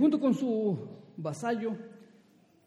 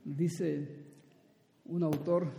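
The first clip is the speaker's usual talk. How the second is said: ordinary delivery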